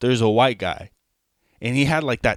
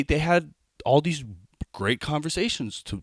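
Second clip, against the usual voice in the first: usual speaking voice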